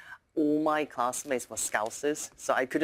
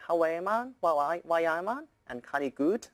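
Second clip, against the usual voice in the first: higher Geordie accent, playful; 5 semitones higher than usual. Shown as a demonstration. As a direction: do a Newcastle Geordie. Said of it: "Geordie in Newcastle, people say".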